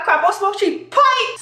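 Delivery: high-pitched, lightly Bajan delivery; slightly Bajan accent. Acted. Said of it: "Slightly Bayesian".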